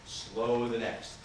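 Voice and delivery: drawn-out and very slow. Shown as a demonstration. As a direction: stretch every word. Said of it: "vary that pitch and pace".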